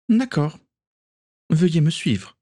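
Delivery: put-on high, polite young woman; Akiko's voice. A narrator's reading. Akiko inviting Denis to follow her, high and polite.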